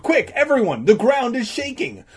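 high, urgent yelling; screamed. Acted. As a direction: shout frantically to a whole room. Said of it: "Danny screamed".

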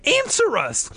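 high, forceful cry; desperate high-pitched order; demanding. A narrator's reading. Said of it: "Margaret demanded".

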